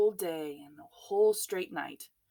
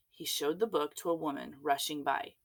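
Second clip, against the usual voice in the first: usual speaking voice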